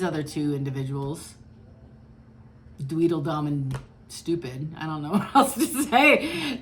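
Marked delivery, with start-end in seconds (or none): 5.1-6.6 laughing mid-sentence, higher and louder; breaking into a laugh. Real, not acted. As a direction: laugh through the words. Laughing at own insult.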